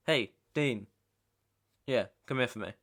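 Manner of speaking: loud, commanding summons; calling out. Acted. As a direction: call an underling over loudly. Boss calls an underling over.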